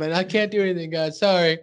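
a hint of a flamboyant Big Al; mild lilting apology; a light take on Big Al; lightly voiced Big Al. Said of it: "that's not what convinces them".

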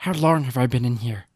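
acted feeble questioning; frail prisoner. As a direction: voice a bewildered, broken prisoner. Same frail prisoner speaking.

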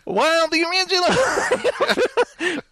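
slow, lightly nasal reviewer voice breaking into a laugh; nasal critic voice. Acted. Retrying the review voice for his co-host, laughing. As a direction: try the nasal voice and crack up. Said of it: "he's very nasal".

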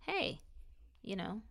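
ordinary talk

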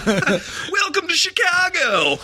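high, slow put-on voice; mock impression. Joining the Obama riff, high and slow mock impression. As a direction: stretch it into a hammed-up welcome.

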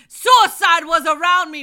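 high, loud cry; preaching shout. Real, not acted. Preacher shouting out her testimony. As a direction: belt it out to the congregation.

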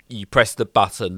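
ordinary talk, nothing marked

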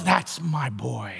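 low, slow, soft and proud; proud fatherly murmur. Retold or imitated. Preacher voicing God proud of Jesus, low fond murmur. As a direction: murmur it like a father beaming at his son. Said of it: "he looks at him and goes".

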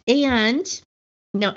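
normal talking voice

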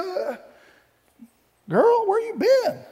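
put-on high, slow, sassy "girl, where you been"; sassy Naomi. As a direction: ham up a nosy mother-in-law, drawn out. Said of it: "like I said, my version".

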